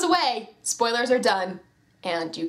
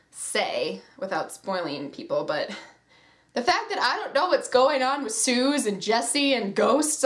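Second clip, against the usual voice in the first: ordinary delivery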